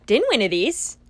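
put-on stumbling into a Scottish accent; clumsy accidental Scottish lilt; switching accents clumsily. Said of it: "what accent is that? Scottish?".

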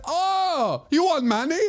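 put-on very high, eager Chinese-accented offer; high-pitched Chinese accent imitation. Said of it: "do the accent for that story".

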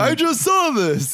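slow, high, whiny put-on voice; mock annoyed viewer. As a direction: drag it out like a fed-up moviegoer. Mocking an annoyed audience member.

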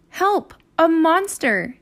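put-on high, excited little-girl cry; a kid calling out in mock alarm; Annie yelling. Annie calls Jack about a monster, high and excited.

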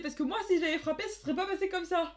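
fast, high, loud laughing mock threat; mock threatening lady. Sister mimics Morticia threatening her, laughing mock threat. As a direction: spit it out like a bully. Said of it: "elle disait".

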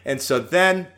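usual speaking voice

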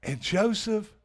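ordinary talk

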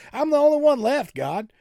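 mock woe-is-me lament; hammed-up self-pity, pitched high then easing down; Elijah. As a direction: complain to God, high and loud. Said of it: "What a woe is me moment".

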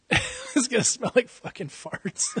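high giggling through the words; can't hold back the laugh. Real, not acted. Cracking up at fart joke.

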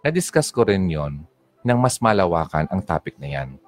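ordinary talk, nothing marked

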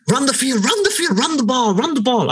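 urgent high-pitched sideline chant, mocking; passionate American fans. Mimicking American fans at a Chelsea game, super passionate. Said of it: "super passionate about every little play".

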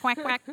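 high, loud duck quacks. Pretend. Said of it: "you have to be a duck".

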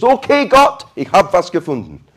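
high, loud, giddy prayer; excited driver. Pretend. Driver who just found a spot, high and loud to God. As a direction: blurt it out, thrilled.

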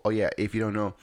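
plain reading voice

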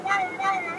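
high wailing siren wow; ambulance siren impression. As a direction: wail it like a passing siren. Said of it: "That's a really good impression".

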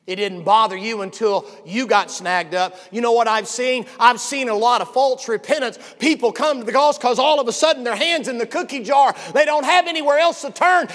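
loud, high shouting sermon; fiery pulpit shouting; preaching at full force. Acted. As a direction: thunder the horror story.

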